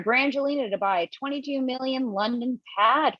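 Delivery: usual speaking voice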